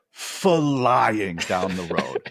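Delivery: "flying" stretched very long and loud; drawn-out emphasis. Real, not acted. Storyteller describing a speeding pickup truck, "flying" hugely drawn out. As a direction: lean hard on FLYING, dragging it out slowly.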